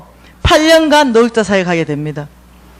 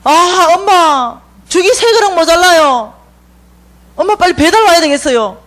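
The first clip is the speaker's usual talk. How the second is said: son calling mom; 6 semitones higher than usual. Retold or imitated. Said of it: "이러니까".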